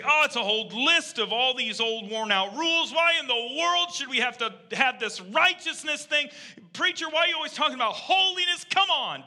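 high-pitched, exasperated griping; mock complaint. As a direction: imitate a whining churchgoer fed up with rules. Imitates people complaining about rules.

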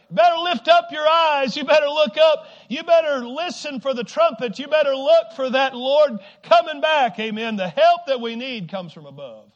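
high, loud urgent exhortation; shouted preaching. Real, not acted. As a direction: call the church to attention at full voice.

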